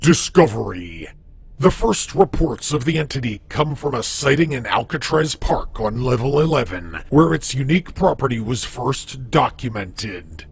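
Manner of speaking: measured, formal reading of a creepy database file; documentary entry read. Acted. Reads a Backrooms database entry.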